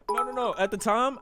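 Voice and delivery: normal talking voice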